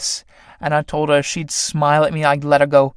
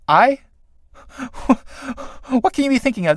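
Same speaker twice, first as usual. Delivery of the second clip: laughing it off; 10 semitones higher, faster than usual. Acted. Felix laughs off old love.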